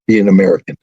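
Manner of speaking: normal talking voice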